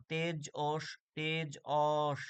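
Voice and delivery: তেজ অস split in two, the "o" of অস drawn out; syllable breakdown. Shown as a demonstration. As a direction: slowly say তেজ, then a long "osh" for অস. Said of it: "অর মতো উচ্চারণ হচ্ছে". (তেজ অস ভেঙে, অ টেনে)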